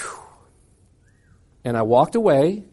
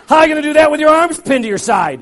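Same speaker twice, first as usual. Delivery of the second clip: teasing laugh; 9 semitones higher, 7 dB louder than usual. Retold or imitated. Speaker teasing.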